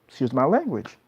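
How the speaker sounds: usual speaking voice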